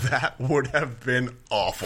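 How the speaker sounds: drawn-out words through laughter; amused disbelief. Real, not acted. Host reacts to Shia LaBeouf as lead, laughing in disbelief. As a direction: laugh while slowly condemning it.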